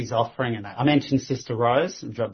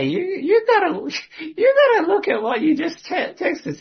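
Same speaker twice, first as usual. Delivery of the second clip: laughing. Real, not acted. Laughing disbelief at her text.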